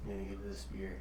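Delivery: near-whispered solemn verdict; hushed rating announcement. Acted. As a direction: announce the score in a low hush, pausing between phrases. Announces own beer rating.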